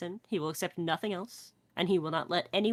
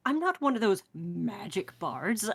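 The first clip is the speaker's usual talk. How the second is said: haughty bard; 3 semitones higher, 4 dB louder, slower than usual. Acted. Bard boasting in character.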